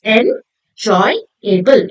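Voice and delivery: 'enjoyable' very slow, split en, joy, able; syllable by syllable. Shown as a demonstration. Teacher breaking the word up for children, syllable by syllable. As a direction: leave gaps inside 'enjoyable'. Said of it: "made up of three syllables".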